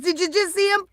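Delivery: ordinary talk, nothing marked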